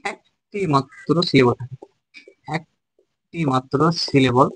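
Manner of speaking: slow and deliberate, each word separated; slow dictation. Shown as a demonstration. Teacher dictating the monosyllable rule to students, slowly. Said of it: "teacher repeats the definition for notes".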